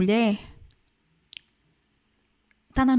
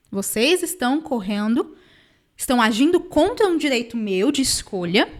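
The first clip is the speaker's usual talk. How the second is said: ordinary talk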